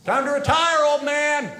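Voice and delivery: put-on cheeky heckle at an old wrestler; yelling up. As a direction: yell it up at him. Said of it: "I yell up to him".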